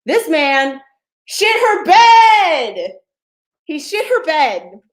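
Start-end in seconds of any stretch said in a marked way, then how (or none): 0.1-2.9 slow, drawn-out shout, much higher and louder; punchline shout. Acted. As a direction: drag out "shit her bed" in a big high yell. Punchline of the story.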